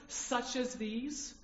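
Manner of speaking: "Such as these" drawn out slowly, each word set apart; slow contrast. Shown as a demonstration. Preacher contrasting Greek phrases for the congregation, slow and deliberate. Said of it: "You see the difference?".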